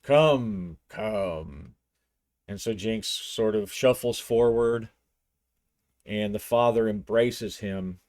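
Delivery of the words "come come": beckoning patriarch. A narrator's reading. Father beckons Jinx forward.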